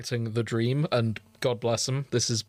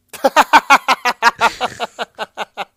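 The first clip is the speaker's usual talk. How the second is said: long loud burst of laughter; big laugh; 11 semitones higher, 15 dB louder than usual. Real, not acted. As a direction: laugh hard at the punchline. Laughs at own story.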